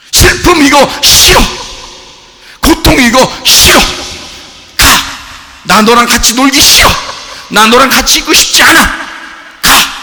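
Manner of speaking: high, loud, building refusal; escalating shouted rejection; shouting it away. Retold or imitated. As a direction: yell at sadness to leave. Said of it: "이 아포티나스예요".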